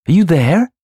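high, loud searching call. A narrator's reading. Louise gets no answer in the cellar, calling. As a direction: call anxiously into the dark. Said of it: "Louise called".

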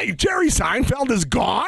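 mock horror at the news; shocked. Shocked high voice.

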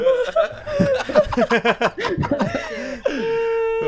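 high, loud laughing fit; real laughing fit; howling laugh. Real, not acted. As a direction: crack up helplessly. Crying with laughter.

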